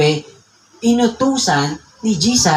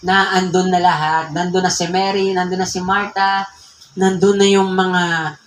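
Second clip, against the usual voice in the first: usual speaking voice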